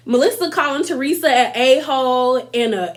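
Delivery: ordinary delivery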